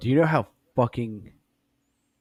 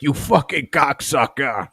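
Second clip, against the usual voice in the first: slow, higher, louder insult; angry yell; 7 semitones higher, 7 dB louder, slower than usual. Pretend. Joey Diaz deer yelling at the cameraman, slow and loud. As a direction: spit out the curse loud and slow. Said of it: "I was like".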